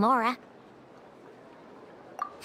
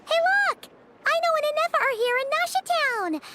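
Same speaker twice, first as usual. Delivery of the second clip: excited call; 9 semitones higher than usual. Acted. Spots friends and calls out.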